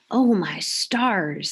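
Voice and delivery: slow drawn-out dismay; dismayed exclaim. Acted. Mister Kelly finding Tom gone, slow dismay. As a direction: sound taken aback.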